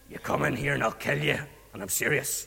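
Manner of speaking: understated kingly menace; threatening king. Acted. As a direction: deliver a low threat as the king. King repeats his threat.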